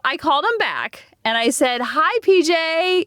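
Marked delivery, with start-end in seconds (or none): 1.8-3.0 shy sing-song greeting. Retold or imitated. Calling crush back shyly.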